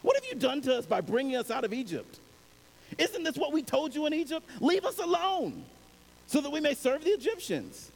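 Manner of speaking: high, frantic pleading of scared people; the Israelites panicking and blaming Moses; panicked complaint. Acted. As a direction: cry out in alarm to Moses. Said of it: "were terrified and cried out".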